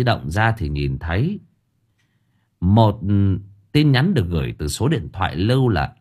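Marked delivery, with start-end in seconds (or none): none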